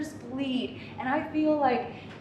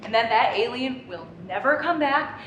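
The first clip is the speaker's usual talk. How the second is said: usual speaking voice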